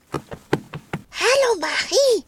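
a hint of a little dragon hissing a hello; lightly voiced small dragon, greeting. Little dragon Rüdiger greets his friend Marie, lightly hissed. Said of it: "fauchte er".

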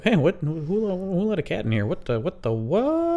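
high-pitched, baffled student voice; weird-talking student. Acted. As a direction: squawk it in confusion, stretching the last word high. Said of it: "Students at U W Madison talk weird".

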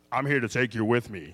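low, eerie menace from a dream intruder; creepy voice. Retold or imitated. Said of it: "in this really creepy voice".

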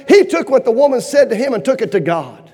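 ordinary talk

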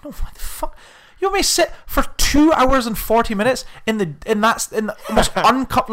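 high, incredulous mock outrage; retold indignant protest. Acted outraged reply to a suggestion.

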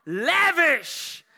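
high, loud, drawn preacher's cry; exuberant emphasis. Real, not acted. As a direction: crown the list with a loud exclamation. Climax of a list of synonyms.

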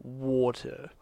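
low, soft British "Water." with dropped r; granddad's British accent. Retold or imitated. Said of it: "He just goes".

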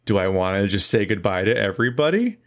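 ordinary talk

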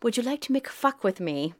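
lightly imitated German man asking; mock German accent. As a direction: put on a light German accent for the pickup line. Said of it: "continually ask me".

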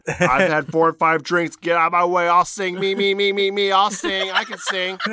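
put-on drunk diva voice. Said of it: "She said".

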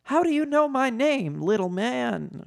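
high-pitched mock shout; shouted question. A narrator's reading. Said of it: "shouted Leia".

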